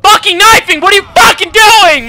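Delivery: high, loud exasperated scolding; angry yelling. Real, not acted. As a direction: yell at your own game character in fury. Scolding own character.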